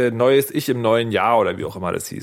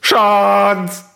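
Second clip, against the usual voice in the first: shout; 6 semitones higher, 6 dB louder than usual. Real, not acted. Calling out.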